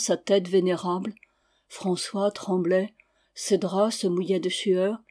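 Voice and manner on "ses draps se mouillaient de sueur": plain reading voice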